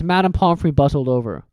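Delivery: ordinary delivery, nothing marked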